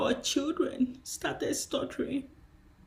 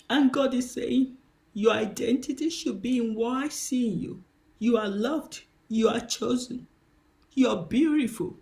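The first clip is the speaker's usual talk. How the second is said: ordinary delivery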